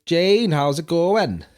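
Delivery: slow, lilting Welsh with stretched vowels; Welsh accent demo. Shown as a demonstration. Co-host showing the learner how, drawn-out Welsh accent. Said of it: "So it'd be like".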